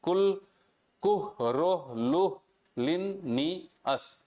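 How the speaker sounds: "कुह, रो, लुह, लिन, नी, अस" spelled out in separate, slow syllables. Shown as a demonstration. Teacher showing students how to say Linnaeus, syllable by syllable. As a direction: break the name into beats: कुह, रो, लुह, लिन, नी, अस. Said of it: "इसका pronunciation कैसे करेंगे?".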